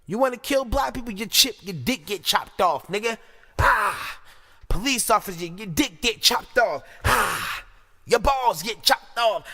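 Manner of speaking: high, loud punchy tirade; shouted threat. Real, not acted. Commentator threatening killers with guillotine, loud and heated.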